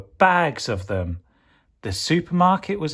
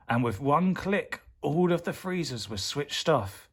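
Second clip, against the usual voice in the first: ordinary delivery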